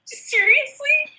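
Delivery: high, slow, disbelieving Seriously; incredulous. Real, not acted. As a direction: stretch it out in disbelief. Mock disbelief at Yorkshire.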